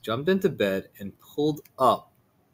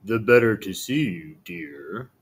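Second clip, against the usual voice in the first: sly wolf voice; 3 semitones lower than usual. Acted.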